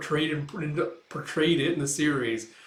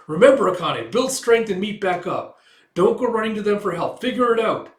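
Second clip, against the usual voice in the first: loud, raised pitch, firing yourself up; forceful pep talk; 6 semitones higher, 7 dB louder than usual. Acted. Acted self pep talk, smacking face.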